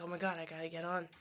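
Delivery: hushed rushed whisper. Retold or imitated. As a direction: whisper it quickly under your breath. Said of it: "so I was like".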